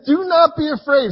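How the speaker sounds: "Do not be afraid" called out loud and high; proclaiming. Acted. Preacher crying out God's command to the church, loud. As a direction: ring it out like a preacher's cry.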